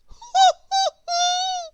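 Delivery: very high tiny laugh; a bright little mouse laugh; squeaky mouse giggle. Acted. Squeak laughing to teach Grizzle, squeaky mouse laugh. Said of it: "Laughed Squeak".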